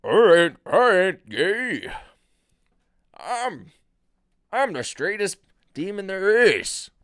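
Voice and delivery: loud, high, hammed-up demon; slow, belted demon protest. Demon insisting he's straight, loudly indignant. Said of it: "I'm fucking up the demon voice".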